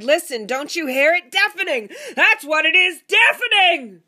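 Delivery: loud, high, insistent yell; shouting. A narrator's reading. Husband insists to wife on thunder, shouting. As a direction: bellow it, stretching the last word.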